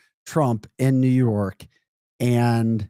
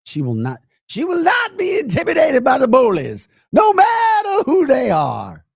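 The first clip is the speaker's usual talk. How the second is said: shrill high mock shout of her defiant statement; hammed-up mock shout, far higher and louder; 14 semitones higher, 6 dB louder than usual. Mocking the attorney general's defiant statement, high mock shout. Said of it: "That's how they talk".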